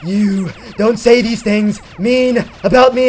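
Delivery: slow, loud, hammed-up enraged voice; breathing-heavily rage. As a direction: imitate her fuming, forcing out each word. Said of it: "she's just breathing heavily".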